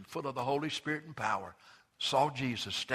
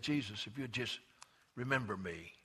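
quiet plea; 3 semitones lower, 8 dB quieter than usual. Acted.

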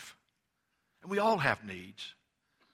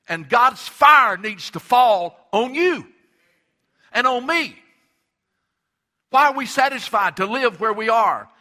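much louder, higher, driving sermon cadence; full-force preaching; 8 semitones higher, 11 dB louder than usual. Acted. Preacher building to a shout that nothing is too hard for God. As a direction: preach it to the rafters, hallelujah. Preaching climax, fire fell.

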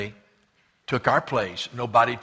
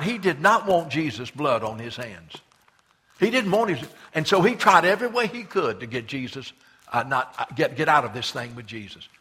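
ordinary delivery

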